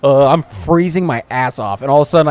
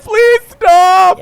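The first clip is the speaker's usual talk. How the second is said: put-on high loud pleading girlfriend; 4 dB louder than usual. Girlfriend begging drunk boyfriend to quit, high loud plea. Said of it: "his girlfriend's like".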